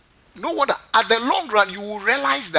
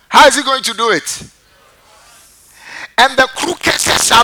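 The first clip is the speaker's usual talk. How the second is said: full-force preaching; 4 semitones higher, 7 dB louder than usual. Acted. Pastor proclaiming Isaiah to the congregation, loud and ringing. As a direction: thunder the verse out to the congregation.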